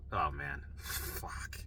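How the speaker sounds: low, quiet breakdown, last word whispered; mock tearful defeat under the breath; imitated crying. Patient breaking down before the nurse, quietly near crying. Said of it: "I'd start crying".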